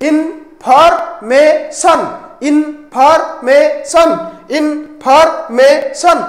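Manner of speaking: stretched out syllable by syllable, slowly; slow syllable demo. Shown as a demonstration. Teacher to pupils, counting syllables, drawn out slowly. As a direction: sound out every syllable of information slowly. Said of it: "ତ କେତେଟା ସିଲାବଲ?".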